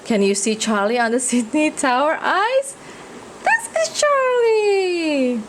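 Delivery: ordinary talk, nothing marked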